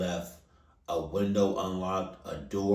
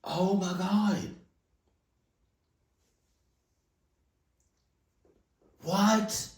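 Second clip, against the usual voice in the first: teary disbelief; 10 semitones higher, slower than usual. Real, not acted. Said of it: "my eyes are watering".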